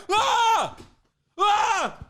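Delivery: loud high staged yelps; hammed-up "Ah!" screams; mock cries of pain. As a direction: yelp as if putting on pain for attention. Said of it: "You're like".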